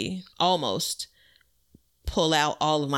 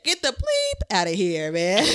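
laughing dismissal; 4 semitones higher than usual. Acted. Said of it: "I'm just gonna leave it at".

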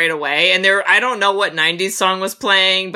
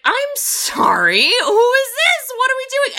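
baffled disbelief. Retold or imitated. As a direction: act stunned, as if nothing makes sense. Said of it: "I was like".

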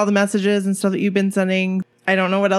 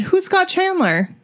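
confused question; 11 semitones higher than usual. Retold or imitated. Retelling her confused question.

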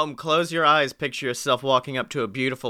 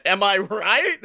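ordinary talk, nothing marked

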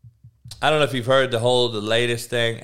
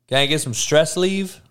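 normal talking voice

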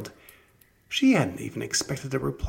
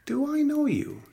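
high, startled question; Ella amazed at a stranger; 13 semitones higher, 3 dB louder than usual. A narrator's reading. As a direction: jump up in pitch, caught off guard. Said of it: "asked Ella, amazed".

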